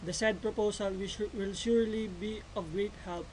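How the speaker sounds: ordinary talk, nothing marked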